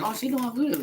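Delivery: mocking gossip. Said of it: "people be like".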